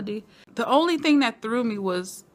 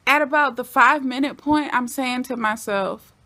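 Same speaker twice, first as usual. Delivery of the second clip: normal talking voice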